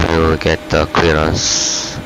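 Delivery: normal talking voice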